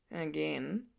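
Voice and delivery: a sigh of resignation; husband groaning about the broken dishwasher; weary husband. A narrator's reading. Clint voiced lower.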